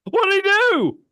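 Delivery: very high, loud, astonished question; astonished outburst. Real, not acted. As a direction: blurt it out high in real disbelief. Listener reacts to the story.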